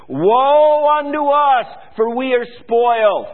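high, loud, slow cry of woe; wailing woe. Acted. Reading the prophet's lament to congregation, loud wail.

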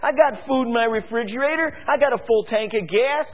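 a smug satisfied citizen; complacent people mocked. Preacher mimics comfortable people ignoring warning, mocking high voice. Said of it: "The people said".

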